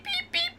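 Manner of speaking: very high tiny peeping; high squeaky beep beep; bird peeps. Retold or imitated. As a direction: peep like a little chick. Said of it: "I hear the beep".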